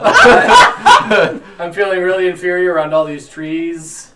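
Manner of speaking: loud laughing, slow. Real, not acted. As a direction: talk through a laugh. Laughing while speaking.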